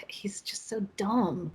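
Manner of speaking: hushed, slowed-down whisper of a confession; breathy whispered admission; whispered aside. Real, not acted. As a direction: whisper it like a guilty secret. Confessing dislike of Ned.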